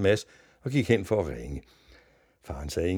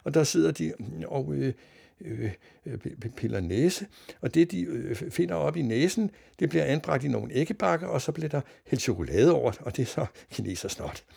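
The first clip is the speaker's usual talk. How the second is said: higher voice, halting with ums; hesitant newsreader; 9 semitones higher than usual. A narrator's reading. Newsreader forced to explain nonsense, halting and puzzled. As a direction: stumble through an explanation on air.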